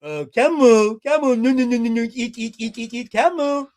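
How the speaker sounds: put-on loud baby gibberish with munching noises; hammed-up mock toddler talk; toddler babble. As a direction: babble like a baby pointing at camels. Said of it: "What she was, like, saying is".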